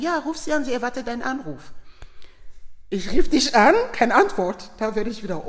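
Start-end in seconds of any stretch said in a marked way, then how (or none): 0.0-1.7 high, louder retold encouragement; eager urging. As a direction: urge a friend on without hesitation. Retold eager urging to phone her. (aufmunternd drängend, mit hoher Stimme)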